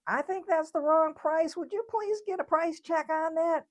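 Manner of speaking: high-pitched, insistent customer impression; lady at the checkout. Lady at the front asking for a price check, high put-on voice. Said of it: "the lady at the front is saying".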